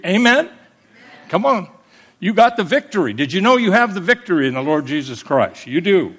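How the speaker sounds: rousing the congregation with the victory message, full preaching voice; full-force preaching. Acted. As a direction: drive it home like a revival preacher.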